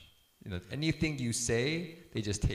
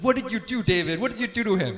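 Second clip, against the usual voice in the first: much higher and louder accusing shout; imitated angry mom; 10 semitones higher, 11 dB louder than usual. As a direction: play the mother storming in, demanding answers. Mom storms in after crying.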